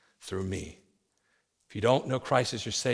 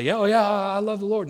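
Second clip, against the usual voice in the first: mock lip service; 6 semitones higher, faster than usual. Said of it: "It's not enough to say".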